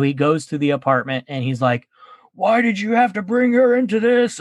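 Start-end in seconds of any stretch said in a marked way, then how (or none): 2.3-4.4 angry confrontation. Retold or imitated. Billy confronting Madani, retold.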